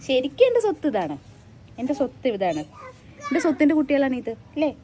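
ordinary talk

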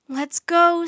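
loud, high, excited call; rally a friend to leave at once; eager urging. Acted. Annie eager to set off.

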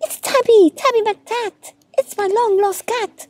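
very high, excited cry; high-pitched joyful storybook outburst; overjoyed recognition. A narrator's reading. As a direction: exclaim at finding a long-lost pet. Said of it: "Fred was so happy".

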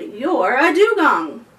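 put-on a boy sure he's right; knowing boy. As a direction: Benji tells the creature what it is. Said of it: "Benji said".

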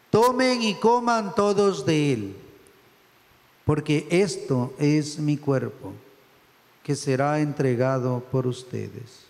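slow, solemn words of institution; consecration words. Acted. Priest speaking Christ's words over the bread, slow and solemn. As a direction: give each phrase weight, slowly.

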